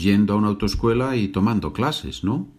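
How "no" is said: ordinary delivery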